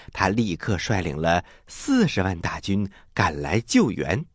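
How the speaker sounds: ordinary delivery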